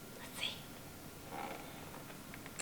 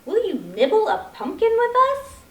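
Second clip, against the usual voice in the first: higher little mouse asking sweetly; 8 semitones higher, 6 dB louder than usual. Acted. Mouse inviting Squirrel to nibble, high mouse voice. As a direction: ask like a tiny mouse, pitched up. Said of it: "said Mouse".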